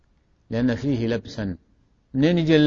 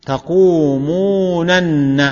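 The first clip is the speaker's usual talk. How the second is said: تقومونن stretched slowly and raised in pitch; drawn-out syllables; 4 semitones higher, 5 dB louder, much slower than usual. Shown as a demonstration. Teacher asking students to count the nuns, تقومونن slowly stretched and higher. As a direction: draw out تقومونن so every nun is heard. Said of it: "كم نون؟".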